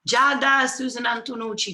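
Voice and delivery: loud, high call of a name; excited greeting. Real, not acted. Host spotting Giada in the comments, excited. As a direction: call out a happy hello.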